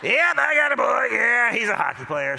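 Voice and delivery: loud, slow, chest-out boast; proud dad. Retold or imitated. Proud father bragging to another dad about his son, loud and slow. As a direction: puff up and announce it proudly.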